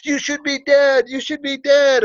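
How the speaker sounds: high-pitched panicked wail; freaking out. Retold or imitated. Man shaking on the ground at seeing him, panicked. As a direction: imitate a terrified man who can't believe it. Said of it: "on the ground in shakes going".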